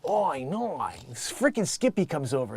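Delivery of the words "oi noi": put-on Australian accent. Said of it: "You're like".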